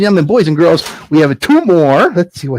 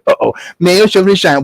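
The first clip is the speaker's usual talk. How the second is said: ordinary delivery, nothing marked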